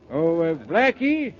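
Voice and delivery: calling out. Acted. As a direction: call his name to get his attention. Calling to man across street.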